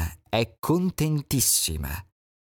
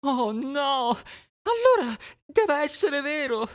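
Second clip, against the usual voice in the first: much higher, frightened; alarmed duckling. Acted. Duckling believing the sky falls, alarmed.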